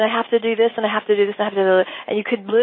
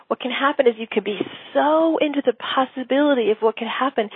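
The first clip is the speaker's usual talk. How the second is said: ordinary talk, nothing marked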